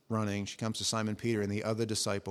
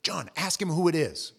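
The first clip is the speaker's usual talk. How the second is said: raised, higher call across a room; yelling across the table; 5 semitones higher, 5 dB louder, slower than usual. Retold or imitated. Peter yells across the table to John, loud calling. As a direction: call out to get his attention. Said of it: "So he yells across".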